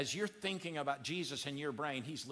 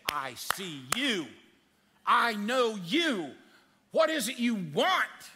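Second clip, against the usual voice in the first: tender divine voice; 4 semitones higher, 7 dB louder, slower than usual. Acted. God speaking tenderly to listener.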